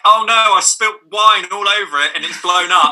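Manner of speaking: mock panic. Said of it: "that's probably like".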